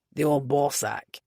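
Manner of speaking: low, slow grumble; muttered. Acted. Keir naming the valet to Merritt, low muttered grumble. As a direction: grumble it under the breath.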